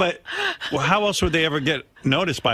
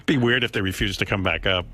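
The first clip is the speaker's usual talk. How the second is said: ordinary talk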